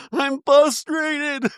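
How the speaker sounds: high, slow whimper; mock crying. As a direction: imitate a tearful whine, high and slow. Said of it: "imitating crying Lisa".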